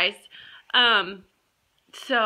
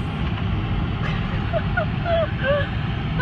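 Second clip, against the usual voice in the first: high unvoiced laugh. Real, not acted. Laugh at remote job.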